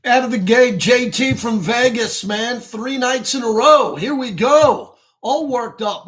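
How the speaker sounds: loud, high, punchy sports-radio intro; radio show opener. Acted. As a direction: kick off the show at full energy.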